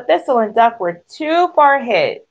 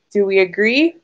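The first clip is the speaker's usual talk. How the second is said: usual speaking voice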